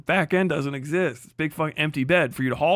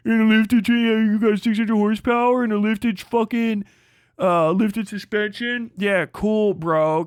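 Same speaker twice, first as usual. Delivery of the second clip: sarcastic; 6 semitones higher, 4 dB louder than usual. Retold or imitated. Ranting mockery of truck bro.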